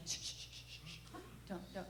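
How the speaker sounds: urgent whispered shush, soft and breathy; hushed shushing. Retold or imitated. Mother shushing her daughter near a boy in a wheelchair, urgent whisper. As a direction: hush her quickly, barely voiced.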